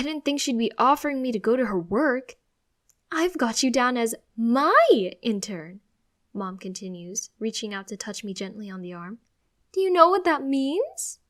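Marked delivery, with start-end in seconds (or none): 3.1-5.8 excited. Acted. Mom gushing about surprise. 9.7-11.2 excited. Acted. Mom gushing about surprise.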